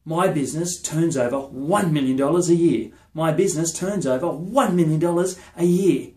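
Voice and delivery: fired-up affirmation, hitting the big number hard; intense, enthusiastic. Shown as a demonstration. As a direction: say it like you fully believe it, building on the repeat. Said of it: "you say it with intensity and enthusiasm".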